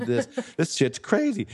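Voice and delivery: high, laughing at how crazy it is; laughing disbelief. Real, not acted. Guest laughing about the marathon runner's misery. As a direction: chuckle through it.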